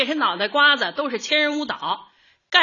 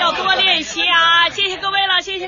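higher, louder closing call to the crowd; 8 semitones higher, 7 dB louder than usual. Acted. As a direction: wrap up the rehearsal loudly for everyone. Dismissing the dance group.

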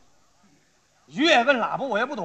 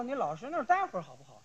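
hushed, quick grumble to oneself; inner thought; 8 dB quieter, faster than usual. Acted. Cyclist silently answers the shouting driver, muted aside. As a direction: drop the voice for a private retort. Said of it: "我心说".

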